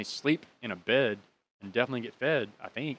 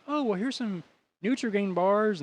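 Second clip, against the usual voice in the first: high-pitched imitated lady's voice; kindly old lady; 9 semitones higher than usual. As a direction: offer snacks in a put-on high voice.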